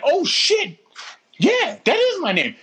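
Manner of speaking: high-pitched amazed reaction; astonished. Retold or imitated. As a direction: retell stunned recognition, voice jumping way up. Brother stunned she knows his name.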